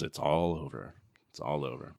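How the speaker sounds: low, hushed, weary-hero line; very soft, low mock-solemn delivery; quiet movie quote. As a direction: drop right down and let it fade away. Quoting the hero's final line.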